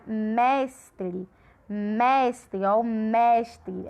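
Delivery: "mestre" slowly, weight on a long "mes"; stress on first syllable. Shown as a demonstration. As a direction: stretch and press the "mes" of "mestre". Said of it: "fala com maior intensidade".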